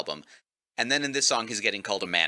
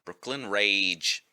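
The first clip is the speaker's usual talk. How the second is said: put-on Brooklyn accent; 2 semitones lower, much slower than usual. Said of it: "really thick Brooklyn accent".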